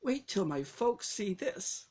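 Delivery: high, squeaked-out whisper of awe; squeaky voice. Retold or imitated. Boy friend squeaking to narrator about the old coin, high squeak. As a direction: squeak it out, barely able to speak. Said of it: "he finally squeaked out".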